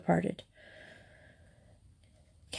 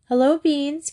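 higher echoed greeting; higher echo of Hello, Beans; echoing brightly; 7 semitones higher than usual. A narrator's reading. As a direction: echo her greeting to the dog, lifted up. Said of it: "Kathy echoed".

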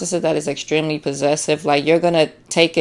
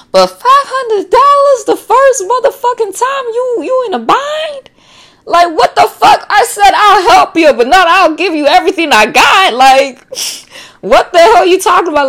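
much higher and louder, sassy indignant rant; sassy outraged rant; 13 semitones higher, 4 dB louder than usual. Pretend. To the greedy friend asking for money, high sassy outrage. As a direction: squeal your outrage like a scandalised diva.

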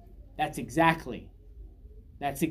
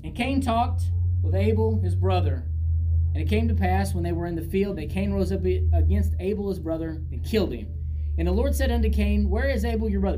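loud, raised, declaiming Bible reading; scripture reading; 3 semitones higher, 7 dB louder than usual. A narrator's reading. Preacher reads verse eight to the congregation, loud scripture reading.

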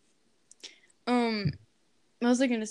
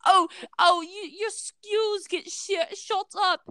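higher, slow mock voice; drawn-out, high-pitched mimicry; mocking impression; 7 semitones higher, slower than usual. Complainer to the speaker, mocking.

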